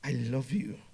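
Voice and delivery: soft, low, intimate voice; tender love declaration. Shown as a demonstration. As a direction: say it gently and sincerely, well below speaking volume. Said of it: "Same sentence, two different meanings".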